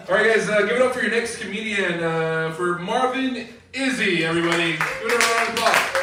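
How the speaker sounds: announcer hype. Real, not acted. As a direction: hype up the next act for applause. Host brings on next comedian.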